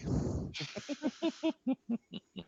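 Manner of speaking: high-pitched burst of laughter. Real, not acted. Laughing at the table.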